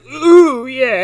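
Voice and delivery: high, loud delighted ooh, yeah. Retold or imitated. Podcast host imitating a pleased bartender, high and gleeful. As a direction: coo it out in mock delight.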